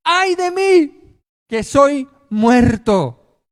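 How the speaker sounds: high, slow anguished wail; terrified cry. Acted. Preacher voicing Isaiah before God, terrified slow wail. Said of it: "él gritó aterrorizado".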